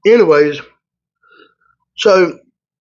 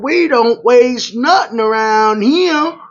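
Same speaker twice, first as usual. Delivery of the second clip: put-on Louisiana accent; 8 semitones higher, slower than usual. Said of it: "do this Louisiana accent".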